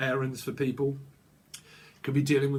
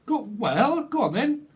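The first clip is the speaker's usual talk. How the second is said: "go on" pitched up high and pushy; egging on; 7 semitones higher than usual. Acted. Reader voicing a friend egging someone on, high and teasing. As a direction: goad them teasingly. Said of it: "Someone's egging someone on".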